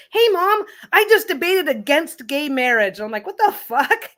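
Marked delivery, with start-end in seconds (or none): 0.1-3.0 put-on high, louder young boy's voice, cheerful; imitating her son. A son telling his mom about the debate: high, cheerful kid voice. 3.3-4.1 high, startled "what the fuck"; shocked reaction. Retold or imitated. A mom hearing her son's debate news: high, shocked outburst.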